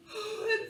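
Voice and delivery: high sharp gasp of alarm. Acted. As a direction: gasp in mock fright. Story alarm, yawns arrive.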